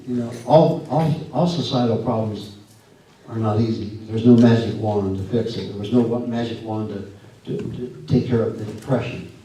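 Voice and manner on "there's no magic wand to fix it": ordinary delivery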